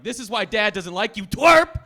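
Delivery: loud, high big-brother taunt; bullying yell. Acted. Big brother dunking a sibling's head, bullying yell. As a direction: yell it, exploding on the last word.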